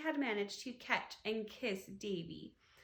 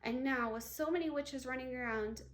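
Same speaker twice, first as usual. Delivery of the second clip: plain reading voice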